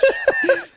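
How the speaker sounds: sudden high cackle; high burst of laughter. Real, not acted. Laughing at co-host's joke.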